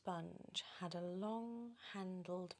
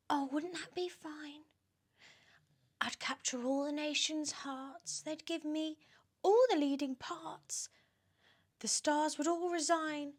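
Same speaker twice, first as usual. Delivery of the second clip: put-on preen like a vain, fat old aunt; high, silly show-off voice; vain Aunt Sponge; 9 semitones higher, 9 dB louder than usual.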